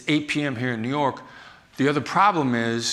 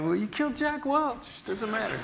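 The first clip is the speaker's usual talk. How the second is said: mock guard; 8 semitones higher, 6 dB quieter than usual. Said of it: "And they go".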